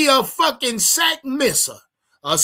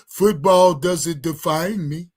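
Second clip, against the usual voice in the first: imitating him; 5 semitones lower, slower than usual. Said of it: "He said it just like that".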